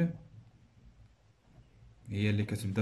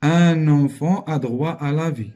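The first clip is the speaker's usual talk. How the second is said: louder, slow reading of the example; 3 semitones higher, 10 dB louder than usual. Shown as a demonstration. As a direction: read the example out slowly to the class. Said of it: "teacher reads example sentence". (phrase d'exemple lue fort et lentement)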